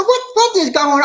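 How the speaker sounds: high-pitched mock bewilderment; retold exclamation. Retelling the moment the video wouldn't play, high exclamation. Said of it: "I said".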